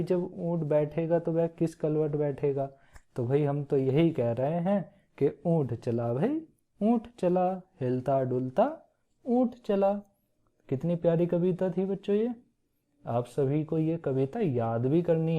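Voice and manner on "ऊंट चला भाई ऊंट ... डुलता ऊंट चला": sing-song recital. Shown as a demonstration. Teacher recites poem to children.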